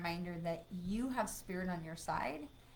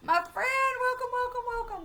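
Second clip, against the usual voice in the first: 1 excited welcome; 9 dB louder than usual. Real, not acted. Greeting a viewer joining the live.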